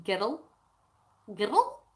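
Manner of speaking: put-on "girl" as "girrul" with a tapped r; Scottish two-syllable "girl"; Scottish pronunciation. Shown as a demonstration. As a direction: repeat "girl" with rolled r, rising as if testing it. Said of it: "I say that Tomb Raider girl".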